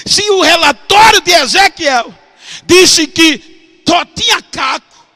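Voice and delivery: loud, high, drawn-out shout; fervent preaching. Acted. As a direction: hammer the point at full force.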